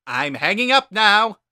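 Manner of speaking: high, loud, booming skeleton brother; loud high cartoon brother voice; Papyrus voice. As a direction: bellow it out like an eager kid brother.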